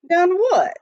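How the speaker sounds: high, loud puzzled question. A narrator's reading. Jimmy puzzled by Billy's question, high and loud. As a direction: snap back in confusion.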